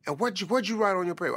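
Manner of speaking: hint of a fussing mother; mom's quick scolding. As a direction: rattle it off as a fussing mom. Mother scolding her son.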